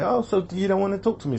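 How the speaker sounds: high-pitched mock girl's challenge; imitated girl. Girl challenges a man walking off, imitated in high voice. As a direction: voice her up high, needling him.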